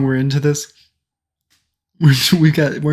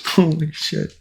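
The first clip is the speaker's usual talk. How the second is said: normal talking voice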